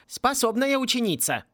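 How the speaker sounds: acted hoarse croak. A narrator's reading. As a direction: wheeze it out in mild exhaustion. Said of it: "прохрипела звезда кунг-фу".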